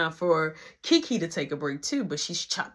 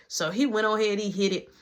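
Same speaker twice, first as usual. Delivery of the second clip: ordinary talk, nothing marked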